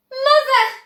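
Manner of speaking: a very high, loud call; excited cry. A narrator's reading. Charlie rushing to his mother, excited high call. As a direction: call out overjoyed. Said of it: "cried Charlie".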